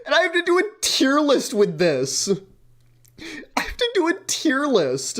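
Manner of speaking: whiny complaint. Real, not acted. Whiny complaint about task.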